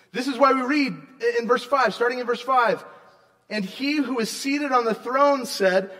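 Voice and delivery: loud, rising sermon proclamation; preaching at full force. Acted. Pastor proclaiming good news to congregation, loud and fervent. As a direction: preach it out with conviction.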